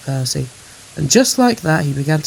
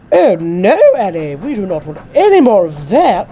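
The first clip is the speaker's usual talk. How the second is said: loud, indignant lady coach; scolding railway carriage character; fussy Clarabel; 5 semitones higher, 10 dB louder than usual. A narrator's reading. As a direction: protest loudly in a higher, fussy voice. Coach Clarabel objects to delay.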